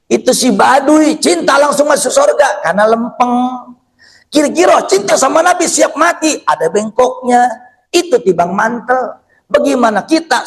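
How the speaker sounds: high-pitched, emphatic sermon; impassioned preacher's oratory; fervent preaching. Real, not acted. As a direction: press the contrast home to the congregation.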